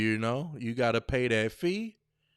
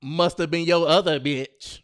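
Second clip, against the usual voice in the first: louder accusing jab; sassy accusation; 4 semitones higher, 7 dB louder than usual. Shown as a demonstration. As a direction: throw it at him with attitude. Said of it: "Instead, say".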